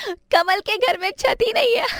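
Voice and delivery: very high-pitched teasing jeer; mocking schoolkid. Classmate teasing Kamal about his roofless house, mocking. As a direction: make fun of a classmate in a high child voice. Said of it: "उसकी गरीबी के लिए चिढ़ाते थे".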